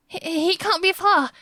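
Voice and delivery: high, panicky stammer. A narrator's reading. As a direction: stumble over the words in fear. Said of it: "she stammered".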